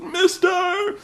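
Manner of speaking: "Mister!" stretched out high as a ring call; [name]'s name call. Retold or imitated. Correcting how the stranger says his name, high drawn-out call. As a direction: sing out "Mister!" very high like an announcer. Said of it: "You're not saying it right".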